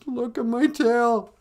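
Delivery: high, slow, loud wail; mock crying. Beaver seeing his ugly flattened tail, mock crying. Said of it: "he starts to cry".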